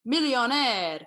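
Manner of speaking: millionaire as Romanian mili-AH-neh, nasal ah; imitating dad's Romanian millionaire; Romanian accent. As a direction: Romanian dad saying millionaire. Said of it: "the way he said certain words".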